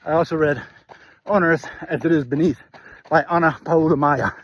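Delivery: out of breath mid-run; talking while jogging, breathing hard; while running. Real, not acted. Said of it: "terrible breathing".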